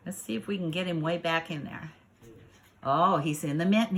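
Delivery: ordinary delivery, nothing marked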